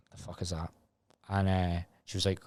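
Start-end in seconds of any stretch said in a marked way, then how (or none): 0.1-0.7 mumbled much softer under the breath; baffled mutter. Retold or imitated. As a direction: mutter it in disbelief, barely audible. Retold shock at Christmas present.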